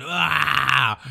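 a loud, high wordless war cry; rage scream. Retold or imitated. Don Creek screams in rage at the restaurant crew, wordless roar. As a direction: let out a furious yell. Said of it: "Schreit einfach rum".

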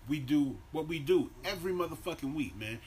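ordinary delivery

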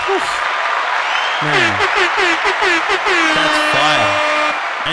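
high, loud hype yell; excited shout. Real, not acted. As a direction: hype it up like a fan. Exclaims after the quote.

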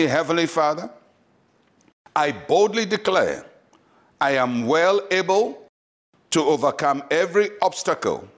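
deliberate declaration in short spaced phrases; led prayer. Acted. Pastor leading listeners in prayer, boldly declaring. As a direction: lead a bold prayer, pausing for repetition. Leads a repeat-after-me prayer.

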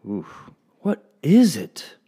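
high, slow, eager lady's question; can hardly wait to know; lady bursting with curiosity; barely held excitement. Acted. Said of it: "barely able to hold in her excitement".

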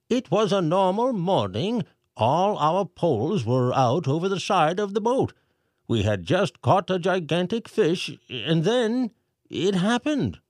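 high, pompous mayor voice reading aloud; mayor reading letter. A narrator's reading. Mayor reads the pelican's message to the crowd, pompous. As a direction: read the message like a fussy mayor.

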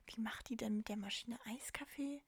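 mother wondering in a whisper; puzzled whisper. Retold or imitated. Mother puzzling how daughter makes iced coffee, whispering. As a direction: whisper it to yourself, baffled.

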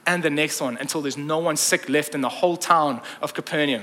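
ordinary delivery

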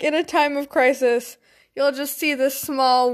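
usual speaking voice

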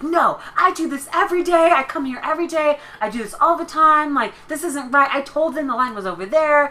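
high, insistent mock Karen rant; indignant Karen. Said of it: "And she's like".

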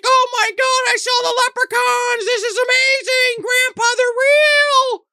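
put-on very high, slowing girl voice; excited little girl. Melanie tells Grandpa the leprechauns are real, squealing high. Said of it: "Melanie's like".

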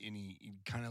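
normal talking voice

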